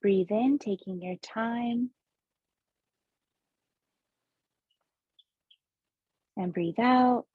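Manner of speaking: slow meditation guide, long pauses between breaths; guided breathing meditation. Acted. Host guides listeners through seven breaths, measured meditation voice.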